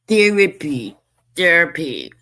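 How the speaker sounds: loud mocking Therapy; a hammed-up sneer on Therapy; imitating her therapy. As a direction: jeer Therapy. the way she says it. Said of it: "Isn't that how she says it?".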